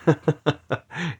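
a brief laugh to himself; short chuckle. Real, not acted. Podcaster to listeners after saying he's emphatic, chuckling.

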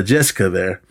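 ordinary delivery

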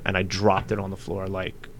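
usual speaking voice

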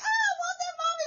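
put-on high, whiny kid begging Mommy; crying child. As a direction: imitate a little one wailing for a treat. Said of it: "hear them crying like".

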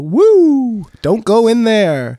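high loud whoop, then slow warning. Acted. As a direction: shout a warning leaving the bathroom. Acting Joe leaving the toilet.

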